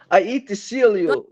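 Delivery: hammed-up Transylvanian accent; Count Dracula. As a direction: play a vampire count. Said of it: "has to talk like this".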